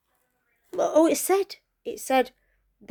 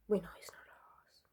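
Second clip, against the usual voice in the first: hushed aside; 4 semitones lower, 16 dB quieter than usual. Real, not acted. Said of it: "whispered aside".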